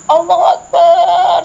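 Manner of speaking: high, loud, drawn-out cry of Allahu Akbar; screamed takbir. Retold or imitated. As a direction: scream it out to the sky in terror and faith. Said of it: "saya menjerit".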